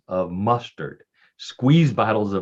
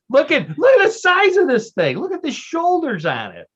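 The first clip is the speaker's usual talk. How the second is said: high, loud burst of amazement; excited exclamation; 6 dB louder than usual. Real, not acted. Host showing co-hosts the huge Bigfoot statue, excited exclamation. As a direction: marvel at it, pitched way up.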